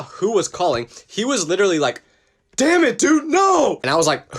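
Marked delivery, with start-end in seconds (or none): 2.6-3.8 high, mock-frustrated groan; imitating a friend's exasperated outburst, pitched high. Friend seeing his girlfriend calling, a high, exasperated outburst.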